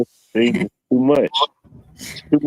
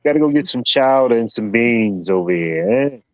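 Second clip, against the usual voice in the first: slow, hammed-up Boston accent, calling for the car; a shaky try at a Boston accent; attempted Boston accent; 2 semitones higher, slower than usual. Joking to his chat friend, calling for the car in a mock Boston accent. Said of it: "that probably ain't Boston".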